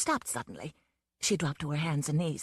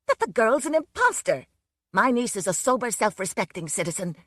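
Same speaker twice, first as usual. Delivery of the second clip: higher, louder indignant protest; shouted; 4 semitones higher, 4 dB louder than usual. Acted. Aunt Elizabeth denying her niece to the police, loud indignant shouting. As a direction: insist loudly she's an imposter. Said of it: "Aunt Elizabeth shouted".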